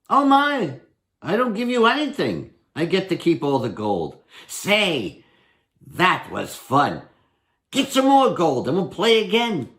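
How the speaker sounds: lightly voiced Herschel. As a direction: explain the rules like a crafty trickster. Herschel wins everything.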